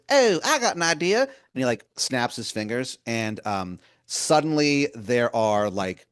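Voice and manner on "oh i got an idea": much higher and louder, a sweet-talking wizard calling you hun; Baltimore wizard voice. Acted. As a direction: wheedle like a pushy old wizard begging a favor. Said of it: "He goes".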